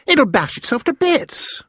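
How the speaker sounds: put-on a worried girl watching the jar; alarmed cry. A narrator's reading. Sophie to the BFG, watching the dream thrash, crying out.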